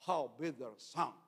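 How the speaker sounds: throaty mock-German sounds, made far back; guttural imitation of German speech. Shown as a demonstration. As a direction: push the words back into the throat. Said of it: "son muy guturales. Se hablan acá".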